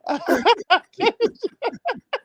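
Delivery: a high, helpless wheeze of laughter; cracking up at the clip, wheezing high. Real, not acted. Host loses it over the viewer's joke, high wheezing laugh.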